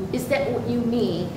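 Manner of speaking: ordinary talk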